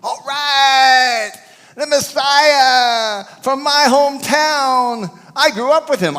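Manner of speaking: high, loud, slow hammed-up crowing; mock hometown boast. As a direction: crow like a proud neighbour. Mock hometown boasting about Messiah.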